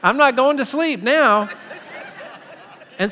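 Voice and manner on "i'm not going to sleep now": put-on louder, wide-eyed child's alarm; mock startled child. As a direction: play a four-year-old, suddenly worried. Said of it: "the four-year-old is like".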